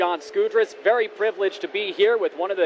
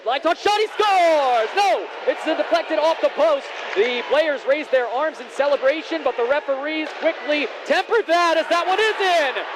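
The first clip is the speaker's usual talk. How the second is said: rising excited shout, higher pitch; excited goal call; 8 semitones higher than usual. Real, not acted. Goal call, live play-by-play.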